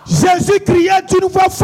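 loud, high-pitched rallying shout; full-voiced, high declamation; shouted preacher's call. Real, not acted. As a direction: shout the verse to set off the crowd. Preacher shouts cue to congregation.

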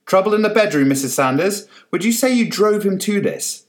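high loud prying voice; pushy reporter. Acted. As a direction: needle her with a prying question.

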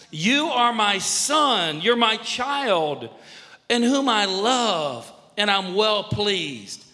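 loud, slow, drawn-out declaration; God the Father's voice. Acted. God the Father owning his son publicly, slow proclamation. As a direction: proclaim each word for the whole world. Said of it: "God the Father's voice came from heaven".

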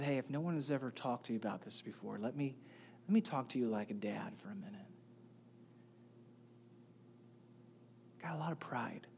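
soft, hushed and quick, like a dad taking you aside; hushed kindly pastor talking like a dad; kind hushed fatherly advice. Retold or imitated. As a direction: lean in and say it gently, almost a whisper. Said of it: "He did it very kindly".

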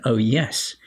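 ordinary delivery

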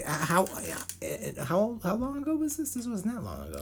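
high squeaky disbelief; incredulous. Real, not acted. Asking cohost how long ago this was, high and squeaky.

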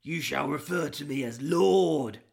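a lightly voiced pirate lord; half-hearted pompous pirate lord. Shown as a demonstration. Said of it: "I'm not very good at pirate voices".

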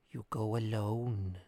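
put-on slow, soft old woman voice; old woman speaking softly. A narrator's reading. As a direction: softly warn him he goes alone. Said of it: "said the old woman softly".

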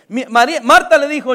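ordinary talk

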